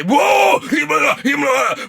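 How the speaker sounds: loud, high ritual shout; mock ecstatic crystal chant; yelling. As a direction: whoop it out like a chant. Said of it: "yelling and stuff like that".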